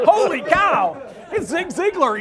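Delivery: blurting it out through a laugh; real delighted laughter; laughing disbelief. Real, not acted. Amazed at seeing Zig.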